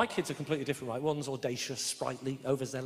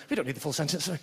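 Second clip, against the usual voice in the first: usual speaking voice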